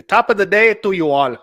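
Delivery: hammed-up Irish greeting for Saint Patrick's Day; bad stage Irish accent; terrible Irish accent. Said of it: "That's my terrible accent".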